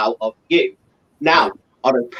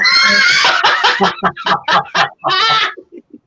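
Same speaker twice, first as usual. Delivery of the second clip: high shrieking laugh; loud laugh; 3 dB louder than usual. Real, not acted. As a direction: burst into cackling laughter. Burst of laughter.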